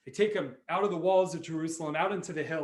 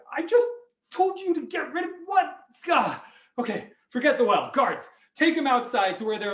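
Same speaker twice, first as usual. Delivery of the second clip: high, loud, hammed-up royal exasperation; exasperated; 8 semitones higher, 6 dB louder than usual. King to guards as the rooster returns, exasperated. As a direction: splutter at the guards, loud and fed up.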